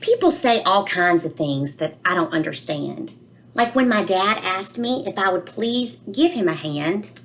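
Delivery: put-on a child's worried storybook voice, read aloud gently; reading to children. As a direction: read it to the class like a puzzled little boy. Reads book aloud, child narrator.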